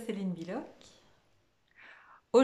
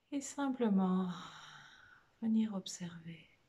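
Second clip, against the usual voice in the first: guided meditation; 4 dB quieter than usual. Real, not acted. Meditation teacher guiding listeners through a body scan, soft guided meditation. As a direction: lead them gently inward, phrase by phrase, leaving lots of space.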